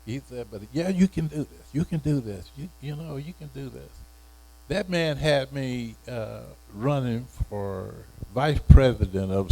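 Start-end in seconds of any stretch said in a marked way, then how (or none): 0.5-3.9 higher, softer, coaxing encouragement; gentle reassurance. Retold or imitated. Instructor soothing a crying student, soft and encouraging. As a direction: coax him softly that he can.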